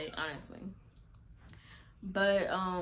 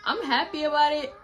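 happy tears breaking through; teary; 6 semitones higher, 6 dB louder than usual. Real, not acted. Telling viewers she was happy, teary and breaking. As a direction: voice breaking, a little higher and louder. Recalling happiness, crying sound.

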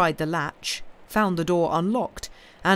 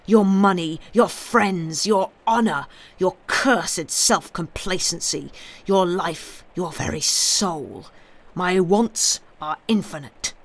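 louder, relentless threat; menacing demand; 5 dB louder than usual. Acted. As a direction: list each one like a blow. Avenger lists his demands.

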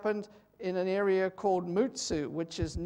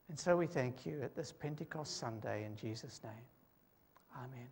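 hushed, reverent prayer voice, much softer; 4 semitones lower, 9 dB quieter than usual. Real, not acted. Pastor opening the Pentecost service with prayer, hushed and reverent. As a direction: pray quietly and humbly to the Lord.

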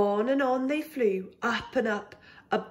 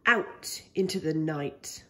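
ordinary delivery

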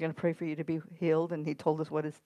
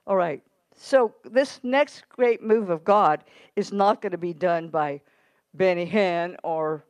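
preaching at full force; 2 semitones higher, 7 dB louder than usual. Acted. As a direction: drive each line at the congregation. Preacher exhorting the congregation.

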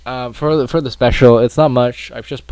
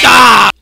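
sudden high scream at a jump scare; startled scream; 11 semitones higher, 16 dB louder than usual. Real, not acted. Gamer jolted by jump scare, screaming. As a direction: shriek as the animatronic lunges.

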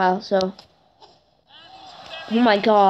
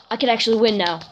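usual speaking voice

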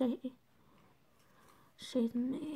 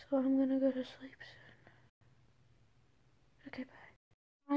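whispering. Real, not acted. Others asleep, late night.

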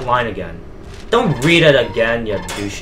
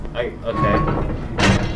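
ordinary delivery

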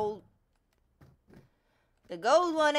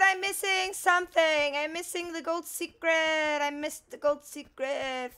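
ordinary delivery

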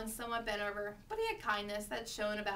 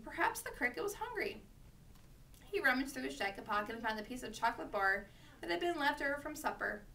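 usual speaking voice

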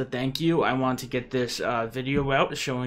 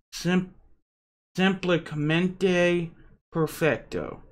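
hammed-up Italian accent, slow and drawn out; mock Italian flourish, higher pitch; 5 semitones higher, slower than usual. Shown as a demonstration.

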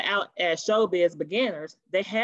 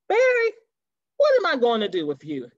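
exasperated demand; 3 semitones higher, 5 dB louder than usual. Acted. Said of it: "he demands".

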